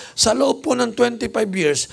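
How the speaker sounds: high, playful setup; teasing questions. Retold or imitated. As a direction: lead her on, voice up high. Bishop teases nurse with questions. (pabirong tanong, mataas ang boses)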